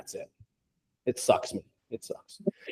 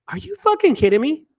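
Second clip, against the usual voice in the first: high-pitched, indignant disbelief; incredulous; 13 semitones higher than usual. Retold or imitated. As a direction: throw it back, unable to believe it. Retells own disbelief at the demand.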